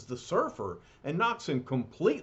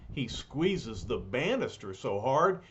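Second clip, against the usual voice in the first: ordinary delivery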